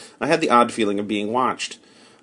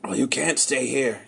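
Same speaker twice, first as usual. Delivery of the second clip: softer, guarded warning; gruff refusal; 5 dB quieter than usual. Acted. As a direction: warn him off quietly.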